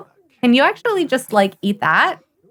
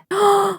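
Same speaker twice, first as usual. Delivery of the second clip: delighted gasp; 11 semitones higher than usual. Real, not acted. Player spotting the read to baby option, gasping.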